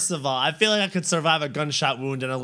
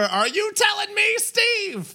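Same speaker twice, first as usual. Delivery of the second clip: outraged shout; much slower than usual. Pretend. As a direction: yell it in disbelief. Imagined last words, outraged.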